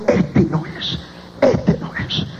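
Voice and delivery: near-whispered, quiet repetition; hushed searching mutter. Retold or imitated. Doctor checks morgue drawers one by one, hushed mutter. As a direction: say it under your breath, body after body.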